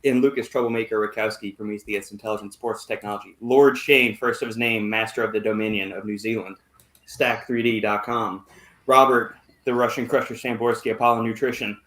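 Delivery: booming showman welcome that settles into a roll call; podcast host intro. Acted. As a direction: introduce the show like an announcer. Host's formal show intro.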